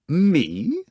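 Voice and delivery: high startled "Me?"; sudden high amazement; amazed. Acted. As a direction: blurt it out, taken by surprise. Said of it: "exclaimed in amazement".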